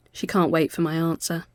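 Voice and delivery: ordinary delivery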